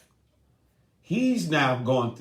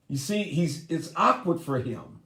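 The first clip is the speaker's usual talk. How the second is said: normal talking voice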